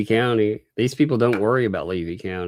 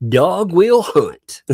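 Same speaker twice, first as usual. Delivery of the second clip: slow, high, loud Southern drawl on Dog will hunt; exaggerated slow Southern saying; 10 semitones higher, 7 dB louder, much slower than usual. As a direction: stretch Dog will hunt out like a good ol' boy. Repeating chat in Southern drawl.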